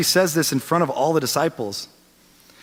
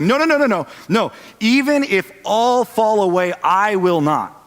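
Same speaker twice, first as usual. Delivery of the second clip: bold proclamation; 7 semitones higher, 5 dB louder than usual. Acted. Peter vows loudly to Jesus he won't fall. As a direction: insist you'll never leave, at full voice. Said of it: "He makes this bold proclamation".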